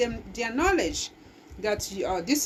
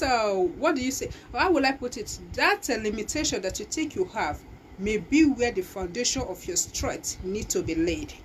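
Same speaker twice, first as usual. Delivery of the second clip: normal talking voice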